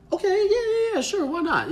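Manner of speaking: high, put-on mom voice; surprised mom. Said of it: "she was a little surprised".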